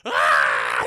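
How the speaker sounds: very loud hammed-up scream of pain; imitated scream. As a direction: scream it out at full volume. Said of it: "He's screaming".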